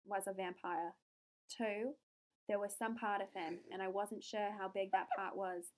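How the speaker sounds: put-on measured Australian accent, a little softened. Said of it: "So for Australian, I think what I'm going to do".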